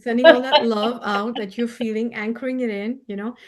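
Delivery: ordinary talk, nothing marked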